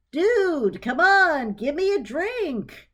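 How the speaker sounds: a houseplant demanding a drink; talking plant. Acted. Tui the aloe plant asking Clarissa for a drink, casual and odd.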